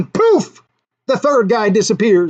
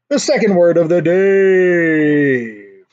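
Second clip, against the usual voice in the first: mock announcer; much slower than usual. Announcer-style title drawl.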